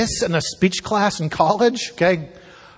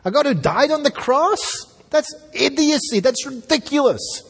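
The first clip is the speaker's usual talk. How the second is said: much higher, incredulous ridicule; incredulous mock squeal; 9 semitones higher than usual. Preacher mocking Gentiles' scorn, high incredulous. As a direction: squeak it out in disbelief.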